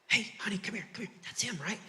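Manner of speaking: hushed, excited whisper to his wife; stage whisper. Retold or imitated. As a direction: whisper it like you just spotted a celebrity. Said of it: "I hear this guy go".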